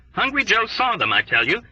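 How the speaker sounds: usual speaking voice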